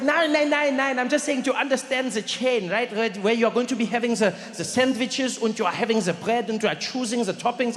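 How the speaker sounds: put-on high, insistent German-accented English; fussy German-accented explaining; German friend's accent. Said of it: "He's like".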